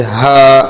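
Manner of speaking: ہا with air blown from the mouth; breathy h. Shown as a demonstration. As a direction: let ہا out as a puff of breath. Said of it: "ہوا نکلے گی منہ سے".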